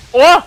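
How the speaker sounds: short, loud, high gasp; startled yelp. Real, not acted. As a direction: yelp at a sudden impact. Startled by meteor impact.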